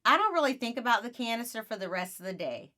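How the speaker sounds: ordinary delivery, nothing marked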